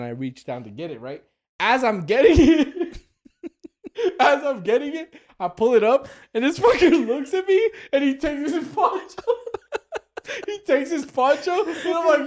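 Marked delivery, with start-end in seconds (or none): 1.6-12.3 high, excited storytelling through laughter; laughing while telling. Real, not acted. Telling friends about the poncho being taken, laughing hard. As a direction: crack up as you reach the punchline.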